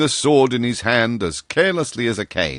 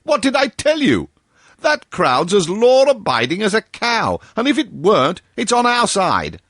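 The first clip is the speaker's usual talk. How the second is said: high, excited bluster; high gleeful voice of a doctor sure he is right; crowing Bull; 11 semitones higher than usual. A narrator's reading. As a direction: crow over being right. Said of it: "he cried".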